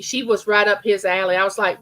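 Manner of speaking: ordinary talk, nothing marked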